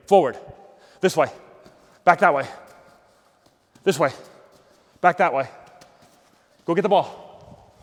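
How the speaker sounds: loud, sharp calls across the field; calling out directions. Real, not acted. Coach calling directions across the field to the player, loud and sharp. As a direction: snap each direction out to the running player.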